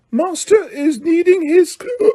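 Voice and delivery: high, slow whimpering elf voice; Winky whimpering about her master's need. A narrator's reading. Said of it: "whimpered the elf".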